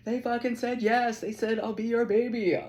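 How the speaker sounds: high, loud triumphant crowing; excited crow. Retold or imitated. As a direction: shout it out proud and thrilled. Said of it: "this crow is like".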